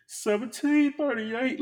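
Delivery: shocked at the price. Real, not acted. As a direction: pitch jumps up in disbelief.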